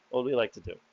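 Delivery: normal talking voice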